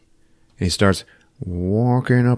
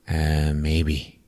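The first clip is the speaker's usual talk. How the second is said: emotionless; 4 semitones lower, 6 dB quieter than usual. Retold or imitated. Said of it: "no emotions".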